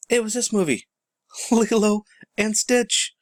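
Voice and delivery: high, laughing reveal of the movie; excited reveal. Real, not acted. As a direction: announce it like a punchline, laughing. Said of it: "big reveal".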